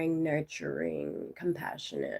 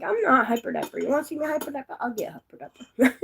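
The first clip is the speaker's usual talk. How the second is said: playful, higher-pitched mock protest; retold defiant retort; 8 semitones higher, 3 dB louder than usual. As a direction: talk back to mom, teasing and half-laughing by the end. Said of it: "I'm like".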